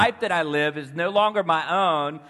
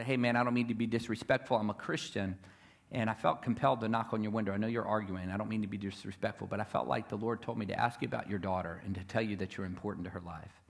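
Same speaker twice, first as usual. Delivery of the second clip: soft, polite, lower; gentle respectful; 6 semitones lower, 9 dB quieter than usual. Retold or imitated. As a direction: speak softly so as not to offend. Gentle approach to arguing couple.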